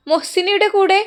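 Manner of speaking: high, loud nurse's call. Acted. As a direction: call out for the patient's companion. Nurse calls for patient's companion.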